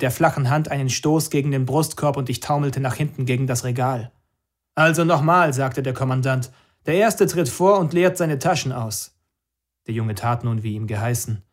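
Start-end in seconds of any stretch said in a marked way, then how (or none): 4.7-5.5 louder, higher clipped command; impatient commander. Acted. Commander restarts angrily. 6.9-9.2 commander's order. Acted.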